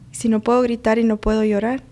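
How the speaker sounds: ordinary talk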